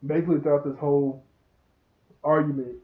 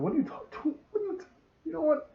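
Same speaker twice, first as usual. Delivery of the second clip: flustered mock protest; 13 semitones higher, 4 dB quieter, faster than usual. Boy flustered when accused in recap.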